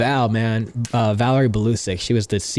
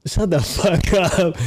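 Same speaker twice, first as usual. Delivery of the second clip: laughing disbelief; 6 semitones higher, much slower than usual. Retold or imitated. Disbelief at store offer.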